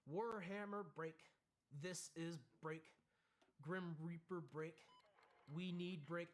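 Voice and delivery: mock broken radio transmission; broken radio call. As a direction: stammer out a dazed transmission. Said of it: "the worst radio transmissions ever".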